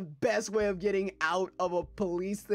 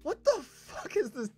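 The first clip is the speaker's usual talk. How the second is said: baffled; 8 semitones higher, slower than usual. Real, not acted. Baffled reaction, slow high.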